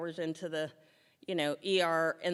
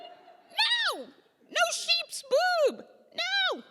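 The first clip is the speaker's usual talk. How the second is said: very high, drawn-out slow "no sheep's boob"; squealed refusal; 5 dB louder, much slower than usual. Retold or imitated. Refusing the surgeon's sheep boob, a very high, slow squeal.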